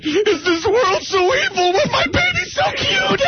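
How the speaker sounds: high wailing laugh-cry; mock crying actor. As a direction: wail over the baby, hammed up. Imitates actor crying over baby.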